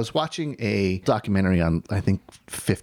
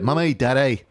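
higher-pitched Northern Irish accent demo; brief attempt at a Northern Irish lilt; 4 semitones higher, 3 dB louder than usual. Shown as a demonstration. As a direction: say it like someone from Northern Ireland. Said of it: "I can say mommy and dad".

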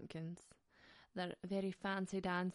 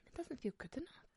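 whispered, higher, pitying; sad whisper; 6 semitones higher, 7 dB quieter than usual. Acted. Voicing the flattened pumpkin's feelings in a whisper. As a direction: breathe it out like a secret sorrow.

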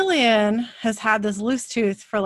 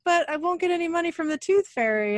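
put-on worried kid voice; 9 semitones higher than usual. Son worried about tooth money.

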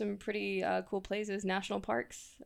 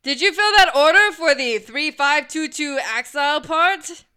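loud cover-up question; 7 semitones higher, 15 dB louder than usual. Acted. Loud office cover line, cold read.

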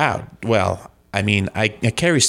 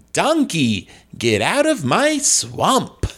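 higher, theatrical comic ogre; John Lovitz Shrek; 5 semitones higher, slower than usual. Shown as a demonstration. Guest shows the host his Shrek, theatrical comic voice. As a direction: order it with drawn-out, hammed-up inflection. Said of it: "what would a John Lovitz Shrek be like".

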